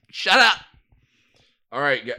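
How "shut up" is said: playful shout. Real, not acted. Snapping back after teasing.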